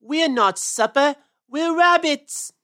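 slow, loud rabbit yell, rising; drawn-out protesting shout. Acted. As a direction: yell each word in indignant protest. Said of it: "yelled Peter".

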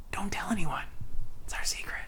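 hushed secret whisper. Pretend. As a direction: whisper it just between us. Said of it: "Why are you whispering?".

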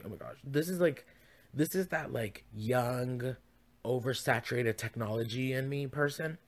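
ordinary delivery

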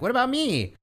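very high pleading squeak; mock Guido's high whine; whiny squeak. Guido left behind at the tower, squeaky plea. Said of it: "And he's like".

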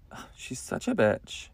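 low, hushed catty aside; muttered disgust. Retold or imitated. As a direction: imitate a girlfriend grumbling under her breath. Girlfriend's sour aside once friend leaves.

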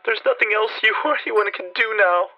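high, loud, desperate pleading; anguished high-pitched plea; despairing cry. A narrator's reading. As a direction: beg in utter despair. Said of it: "a scream of utter despair".

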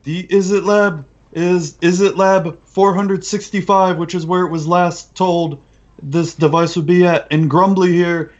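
high goblin voice; goblin boss explaining. Acted.

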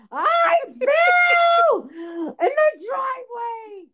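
very high, loud, drawn-out mock cry; drama queen wail. Daughter reports her fall to mother, mock wailing. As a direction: howl it slowly like a melodramatic daughter. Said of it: "she's the worst drama queen ever".

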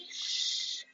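sharp unvoiced shh; quick hushing hiss; shushing. Real, not acted. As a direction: hush someone nearby. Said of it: "You can't talk too loud in here".

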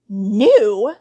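loud, incredulous shriek with leaping pitch; sudden shriek. A narrator's reading. Aunt Petunia rounds on Harry suddenly, shrieking. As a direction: throw the word back as an outraged shriek. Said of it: "shrieked Aunt Petunia suddenly".

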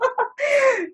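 squealing high laugh; real squealing laughter. Real, not acted. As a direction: burst into a high cackle. Laughing at her vain self.

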